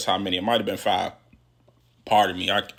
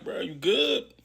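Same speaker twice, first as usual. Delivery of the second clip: raised-pitch questioning, lifting at the end; concerned check-in; 8 semitones higher, slower than usual. Retold or imitated. Inmate to crying man next to him, concerned check-in. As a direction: imitate an inmate checking on a friend.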